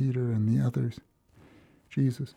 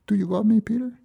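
a lightly voiced Jesus asking, pitched much higher; a hint of a tender teacher, higher and pleading; Jesus asking gently; 10 semitones higher, 4 dB louder, faster than usual. Jesus asking Peter a second time, lightly voiced and higher.

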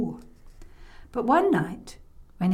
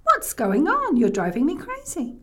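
high, louder scolding; exasperated grandma; 6 semitones higher, 3 dB louder than usual. Acted. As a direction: demand an explanation, fed up. Grandma runs back, scolds.

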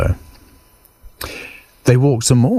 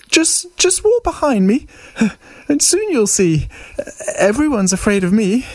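high mouse voice, stammering into a bluff; tiny mouse putting on bravado; boastful little mouse. Acted. Mouse bluffs the Gruffalo about being scary, high mouse voice.

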